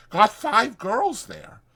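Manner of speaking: chatty ranch hand selling a night in town; Whit's voice. Acted. Whit continuing.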